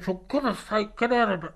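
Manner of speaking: high, exasperated plea. Retold or imitated. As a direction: fuss through the smoke. [name] choking in smoke.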